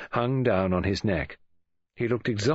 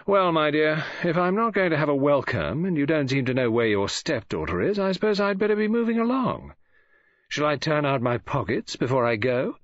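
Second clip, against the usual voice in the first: put-on higher, insolently casual young visitor; airy young man; 6 semitones higher than usual. A narrator's reading. David offers to leave Mary's house, airily. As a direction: take your leave with airy mockery.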